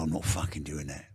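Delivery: low gruff mock of Vinnie refusing; lightly voiced hard man; mimicking Vinnie, low. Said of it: "and gone".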